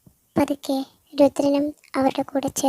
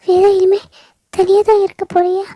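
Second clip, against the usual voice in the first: high, loud, tearful crying voice; sobbing grief; 7 semitones higher, 9 dB louder than usual. Acted. Veda telling Stella her parents died, sobbing loudly. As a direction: wail the news through tears.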